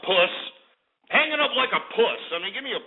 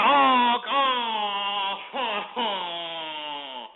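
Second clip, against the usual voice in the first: stretched-out "oh" sliding down and fading; hammed-up sinking groan; mock dismay; much slower than usual. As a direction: wail it teasingly, letting it die away. Mocks caller hanging up again.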